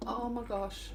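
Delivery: ordinary delivery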